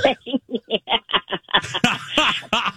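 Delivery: sustained real laughter; easy, amused laughing. Real, not acted. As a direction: laugh it out as the story ends. Laughing as the story ends.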